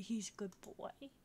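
soft whisper; whispered praise. Real, not acted. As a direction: whisper it like a secret to the dog. Whispering to the dog.

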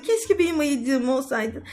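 ordinary talk

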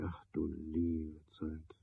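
hushed, drawn-out lament; weary sigh. Acted. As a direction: let it fade out in a sigh. Sigh at the late hour.

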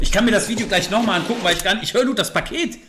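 irritated rant about a rustling package; frustrated outburst. Real, not acted. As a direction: blurt it out fast in frustration. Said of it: "Ich bin grad fast ausgerastet".

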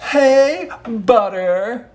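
high, loud call across the shop; mock call out. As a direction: shout a nickname across Starbucks. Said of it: "They reacted by saying".

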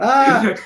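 sudden high loud yelp of delight; excited yelp. Real, not acted. Actor laughing with his son in an interview, a loud excited yelp. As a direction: let out a bright, loud little cry. Playful exclamation after joke.

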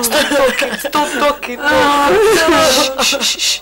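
loud, distraught plea; insistent, emotional order; urgent hushing. Acted. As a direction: cut through the sobbing loudly. Hushing a distraught, weeping woman.

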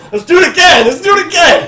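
high, loud celebratory shout; excited cheering. Acted. Two pals high-fiving mid-leap, excited shouting. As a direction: whoop it like a hyped-up teammate.

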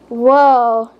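ordinary delivery, nothing marked

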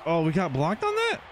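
high, loud incredulous question; loud disbelief. Real, not acted. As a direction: react in real disbelief at the play. Reacts to blocked play in game.

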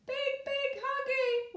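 put-on high, slow, mildly weepy toddler voice; weeping baby. Baby carried away from mother, mild weepy wail. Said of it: "wept the baby".

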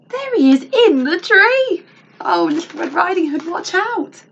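high, loud storytime warning; excited mock alarm. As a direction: cry out to warn the heroine, pitched high. Storyteller spots wolf, calls warning.

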